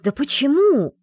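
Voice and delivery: high, loud cry of disbelief; exasperated outburst. A narrator's reading. Secretary hearing the lord refuse the scroll, exasperated. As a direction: cry out why in frustration.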